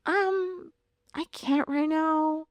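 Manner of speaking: nonchalant. Retold or imitated. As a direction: excuse yourself calmly on the phone, voice lifted. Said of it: "just saying it so nonchalantly".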